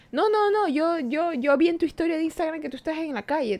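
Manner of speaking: high, pushy insistence; quoting the ex who is sure he saw her out; insistent ex. Retold or imitated. The woman imitates her drunk ex insisting she's not home, pitched high.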